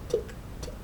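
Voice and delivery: ordinary delivery, nothing marked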